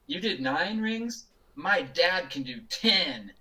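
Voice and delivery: put-on cheeky kid. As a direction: drag it out like a bratty kid, laughing. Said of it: "the kid just looks at Anthony and goes".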